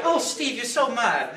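put-on high, amateurish English accent; imitated English accent. Husband imitating his wife calling him mad, English accent. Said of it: "I can't do it very well".

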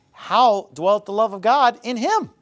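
usual speaking voice

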